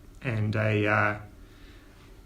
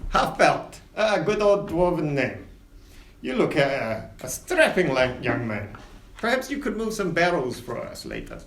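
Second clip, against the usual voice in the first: higher, grand ship captain; captain Winters; 3 semitones higher than usual. Acted. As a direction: welcome passengers aboard with flourish. Captain checks tickets.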